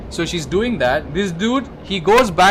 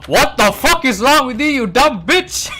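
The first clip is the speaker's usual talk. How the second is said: screaming insults, much higher and louder; furious outburst; 10 semitones higher, 7 dB louder than usual. Retold or imitated. Man yelling at his lover for faking death, furious shout. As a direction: let him explode at her, slowing on the insult. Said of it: "this dude gets so fucking pissed off".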